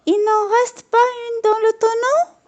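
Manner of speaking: very high, odd little voice asking; tiny high worried customer; petit homme. A narrator's reading. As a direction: squeak the question up high. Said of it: "demande-t-il".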